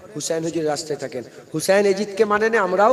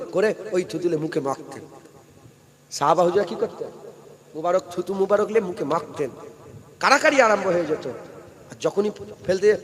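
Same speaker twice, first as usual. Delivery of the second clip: ordinary talk, nothing marked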